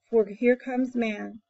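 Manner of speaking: ordinary delivery, nothing marked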